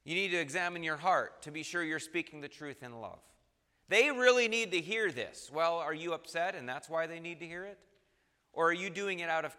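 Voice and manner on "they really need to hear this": eager accuser. Retold or imitated.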